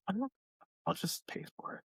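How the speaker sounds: resigned whispered admission; whispered aside. Real, not acted. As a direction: drop to a whisper, giving in. Resigned aside about fundraising.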